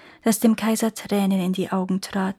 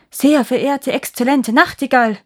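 loud, raised ceremonial address; pompous courtier; 4 semitones higher, 6 dB louder than usual. A narrator's reading. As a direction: proclaim it as a court chamberlain. Chamberlain formally addresses the bird. (laut und feierlich wie ein Höfling)

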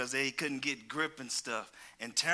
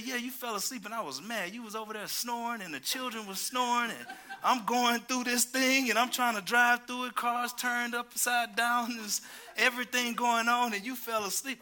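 mock angry wife; 7 semitones higher than usual. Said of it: "She said".

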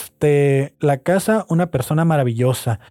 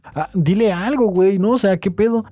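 higher, urging, egging her on; imagined plea; 6 semitones higher than usual. Retold or imitated. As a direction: push her to answer back, half joking. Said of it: "Así de". (animándola a responder, más aguda y en broma)

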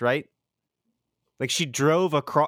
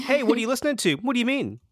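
high innocent customer voice; 10 semitones higher, faster than usual. Retold or imitated. Mimics customer questions.

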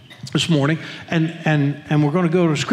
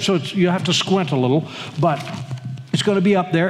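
ordinary talk, nothing marked

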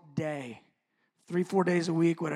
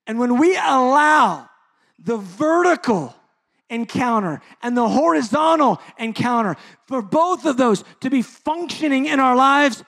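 preaching at full force; 9 semitones higher, 16 dB louder than usual. Acted. Pastor exhorting his congregation, full-force preaching. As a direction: proclaim it to the whole room.

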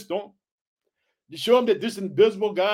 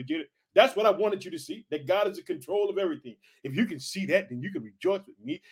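ordinary delivery

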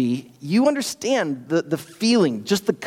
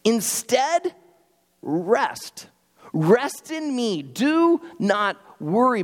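slow and tender, a touch higher; gentle, slow reassurance; 5 semitones higher, much slower than usual. Retold or imitated. Pastor voicing Jesus to the congregation, slow and tender. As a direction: soothe them like a calm shepherd.

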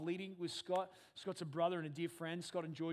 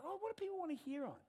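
high bright crowd-pleasing question; mock eager team; 12 semitones higher, 3 dB quieter than usual. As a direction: ask it like a people-pleaser. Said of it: "We're like".

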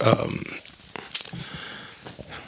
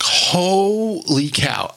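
stretched-out, higher-pitched awe; retold amazement; 7 semitones higher, much slower than usual. Nervous actor walking onto set, acting amazed. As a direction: draw it out, stunned. Retells own shock entering room.

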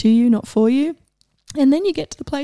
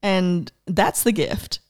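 ordinary talk

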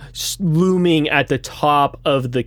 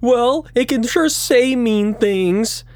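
put-on ghost boy sulk; 8 semitones higher than usual. Jimmy voice continues.

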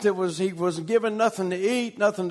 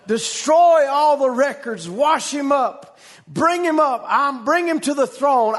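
raised, higher commanding voice; high, ringing royal decree, retold; king's urgent command; 4 semitones higher, 4 dB louder than usual. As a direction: call out the orders like a king. Retold king calling out orders.

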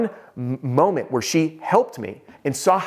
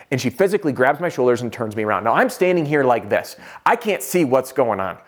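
ordinary talk, nothing marked

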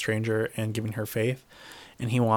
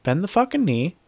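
higher, forceful commanding snap; mock Stark command; 7 semitones higher than usual. S0 voicing a Stark ordering a lord to kneel, hammed-up stern. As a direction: lay down the law like a Stark. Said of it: "It's like".

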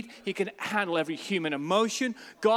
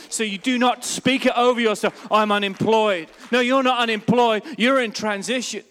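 loud emphatic rebuke of the word; full-force preaching; 4 semitones higher, 8 dB louder than usual. Real, not acted. Preacher telling church not to speak unemployed, loud. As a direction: push hard, refuse it outright.

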